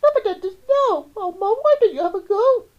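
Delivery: very high, loud plea to Mum; child shrieking against the dentist; shouted protest. A narrator's reading. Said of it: "She shouted".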